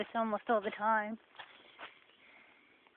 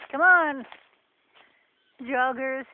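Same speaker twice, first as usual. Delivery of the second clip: calling out; 6 dB louder, slower than usual. Real, not acted. Calling out to imagined joggers.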